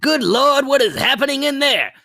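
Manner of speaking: high, alarmed Good Lord; alarmed cartoon boss. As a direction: quote the shocked superintendent. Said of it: "Steamed Hams".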